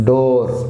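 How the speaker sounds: డోర్ with the o held long; long vowel. Shown as a demonstration. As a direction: draw out the o of డోర్. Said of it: "long pronunciation". (డోర్ లో పొడవైన ఓ శబ్దం)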